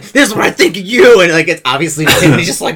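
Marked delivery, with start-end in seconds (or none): 0.0-1.2 high, loud acted yell; defiant outburst. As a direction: hurl it at them in mock rage. Character's defiant outburst at doctor.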